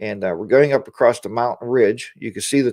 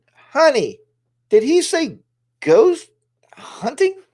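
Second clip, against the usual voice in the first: much higher, incredulous wife voice; imitating his wife; 13 semitones higher, much slower than usual. Vlogger mimics his wife questioning ghost hunting, high and incredulous. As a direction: squeak it out like a disbelieving wife.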